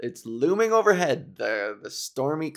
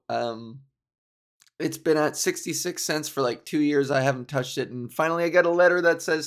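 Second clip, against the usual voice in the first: usual speaking voice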